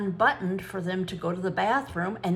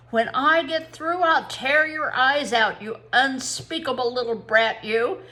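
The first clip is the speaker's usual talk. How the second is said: louder, raging outburst; furious threat; 4 semitones higher, 5 dB louder than usual. A narrator's reading. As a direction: threaten in a storybook rage.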